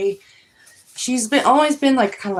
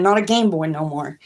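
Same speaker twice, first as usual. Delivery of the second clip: usual speaking voice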